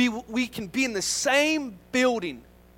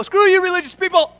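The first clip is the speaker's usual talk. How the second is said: higher, louder mock outburst; mock angry shout; 9 semitones higher, 9 dB louder than usual. Pastor showing what not to say to religious people, mock shout. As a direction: fling it out in mock contempt. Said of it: "you can't go".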